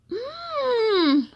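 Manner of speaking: high, drawn-out mmm of pleasure; long high yummy hum; savouring mmm. Acted. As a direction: hum so the others hear you love it. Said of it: "Nari said loud enough".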